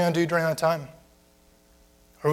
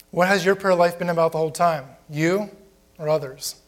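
ordinary talk, nothing marked

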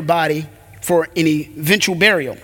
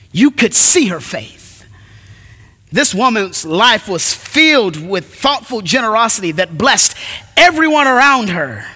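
ordinary delivery

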